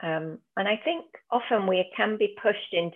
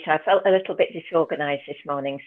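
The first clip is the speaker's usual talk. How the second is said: ordinary talk, nothing marked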